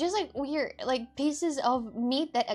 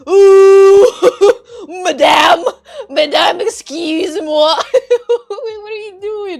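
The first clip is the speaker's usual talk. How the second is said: loud high shrieking madame through laughs; panicked laughing plea; 8 semitones higher, 20 dB louder, slower than usual. Retold or imitated. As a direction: beg her to stop, half laughing, half crying. Stripped by attendant, pleading.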